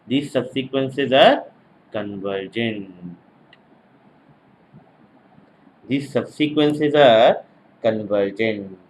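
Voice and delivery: slow dictation, dropping low on the last word; measured note-taking pace with falling close. Real, not acted. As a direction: dictate it slowly, pause before the final word. Dictating conclusion for note-takers.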